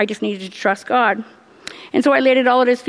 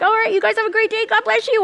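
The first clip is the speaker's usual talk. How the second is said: much higher, airy sing-song goodbye; breezy dismissal; 11 semitones higher than usual. Retold or imitated. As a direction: toss it off cheerfully, in denial. Breezily leaving the doctor's office.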